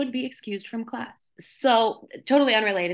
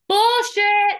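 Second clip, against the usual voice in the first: shouted much higher and louder; mock professor yelling her down; professor's retort; 12 semitones higher, 8 dB louder than usual. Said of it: "professor rejects the take".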